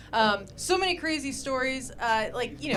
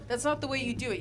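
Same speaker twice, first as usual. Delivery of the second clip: ordinary talk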